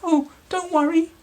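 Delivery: high, quick squeaky reassurance; peeping mouse. Acted. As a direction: mouse soothing the lion. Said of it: "Mouse peeped".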